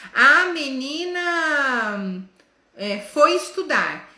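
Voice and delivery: A menina foi estudar spoken very slowly, each word apart; slow teaching sentence for jumping per word. Shown as a demonstration. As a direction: stretch the sentence so each word stands alone. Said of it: "você fala uma frase pra criança".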